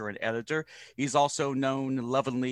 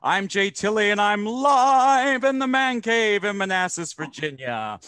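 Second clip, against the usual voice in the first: announcer voice; 7 semitones higher, 5 dB louder than usual. Acted. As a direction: open the show like a ringmaster. Podcast intro announcement.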